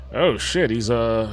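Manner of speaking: ordinary talk, nothing marked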